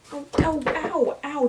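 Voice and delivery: sudden cries of pain. Real, not acted. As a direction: yelp in real pain. Heel hurt by a paper.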